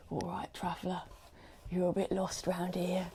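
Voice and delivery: soft ASMR tavern-keeper in mock English accent; horrible English accent. Tavern keeper greeting a lost traveller, hushed mock English accent. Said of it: "like, the horrible English accent".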